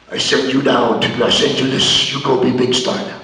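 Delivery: lightly voiced rough mentor; low gravelly mentor. Mad Dog advising in car.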